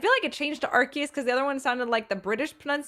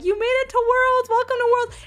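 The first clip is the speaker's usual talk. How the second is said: higher, louder cheering welcome; cheering staff; 10 semitones higher, 5 dB louder than usual. Retold or imitated. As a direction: cheer them into the hall.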